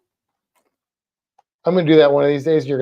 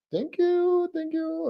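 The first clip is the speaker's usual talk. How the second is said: higher, softer sing-song thanks; sing-song thank you; 13 semitones higher, 5 dB quieter, slower than usual. Pretend. Owner thanking the lizard in a higher coo.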